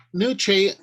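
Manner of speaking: usual speaking voice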